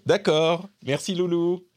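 high, bright thanks to a little son. Real, not acted. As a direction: coo a sweet thank-you. Father thanks his son.

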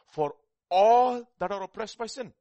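very loud, high cry on the last words; shouted scripture climax. Real, not acted. Preacher crying the verse out to the church, very loud. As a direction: belt the verse out.

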